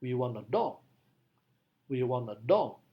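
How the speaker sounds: demo, dog stressed both times. Shown as a demonstration. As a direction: end on a strong dog. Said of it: "Maka stresnya menjadi".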